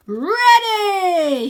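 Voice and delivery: high, loud shout of ready; excited cry. Acted. Wyn crying out before charging the course, excited. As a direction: yell it like a race start. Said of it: "he cries".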